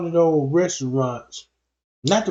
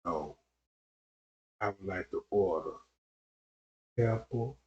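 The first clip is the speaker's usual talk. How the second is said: much lower and softer, a rumble; deep low voice; 9 semitones lower, 10 dB quieter than usual. Shown as a demonstration. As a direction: sink the voice way down to order. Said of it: "You automatically say".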